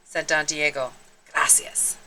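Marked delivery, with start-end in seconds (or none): 1.3-2.0 breathy sheepish gracias; unvoiced little thanks; whispered thanks. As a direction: murmur it shyly. Sheepish thanks after sneeze.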